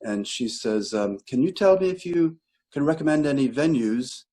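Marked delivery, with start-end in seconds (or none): 1.3-4.2 lightly imitated British lady, higher pitch; a hint of a British caller, pitched up; British accent. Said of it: "this lady with a British voice".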